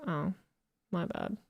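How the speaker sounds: ordinary delivery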